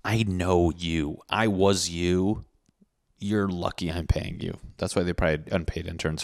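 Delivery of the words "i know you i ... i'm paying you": slow, deliberate words to a new hire; a hint of condescending employer; slow boss lecture. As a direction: drag it out like a jaded boss. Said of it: "I'm like".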